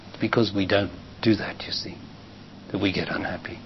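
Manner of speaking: hushed, confiding aside; whisper. Real, not acted. Teacher confiding the root of unhappiness, whispered. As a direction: drop to a whisper to share the secret.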